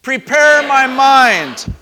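full, high shout, stretched out slow. Shown as a demonstration. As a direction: shout it out to lead the room. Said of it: "we're gonna shout".

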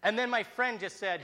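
plain reading voice

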